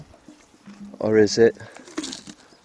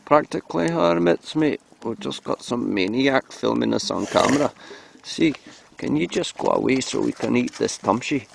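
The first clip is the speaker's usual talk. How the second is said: put-on Scottish donkey voice; Scottish accent. Donkeys asking the cameraman to leave them alone, Scottish accent. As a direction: grumble as a humble donkey in Scots. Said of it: "we can talk in Scottish accent".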